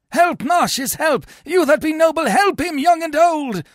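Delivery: high, loud rallying call; crying for help. A narrator's reading. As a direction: summon everyone to the rescue.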